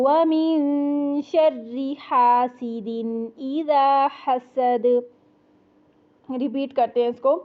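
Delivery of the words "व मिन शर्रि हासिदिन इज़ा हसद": slow melodic tajweed chant of कुल अऊज़ु बिरब्बिल फलक; Quran recitation. Shown as a demonstration.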